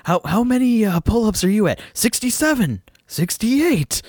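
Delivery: high-pitched mock straining exchange; imitated workout rivals. Two rivals comparing pull-up counts while working out, mock effort.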